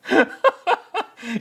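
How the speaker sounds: loud high-pitched cackle; high laugh. Real, not acted. Vlogger cracking up at his impression, loud high laugh. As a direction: let a big squeaky laugh out.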